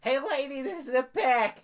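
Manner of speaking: mock fast-food worker. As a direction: play a squeaky cashier scolding the lady. Mock drive-thru worker.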